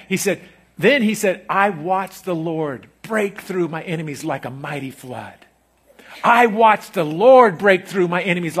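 loud, high revival preaching; fiery sermon climax; preaching at full force. Acted. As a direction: lift the room, preach it out. Preaching David's voice.

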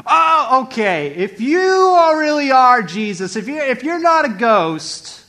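high, drawn-out calling out; loud challenge. Acted. Peter testing Jesus from the boat, loud challenge. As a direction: call across water, testing who it is. Peter loudly tests the figure.

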